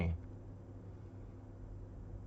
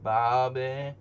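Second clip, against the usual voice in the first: louder Southern calls of Bobby; Southern accent practice; 3 semitones higher, 5 dB louder than usual. Pretend. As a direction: practise the Texan Bobby call. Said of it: "I can't do the King of the Hill, like, the Southern accent".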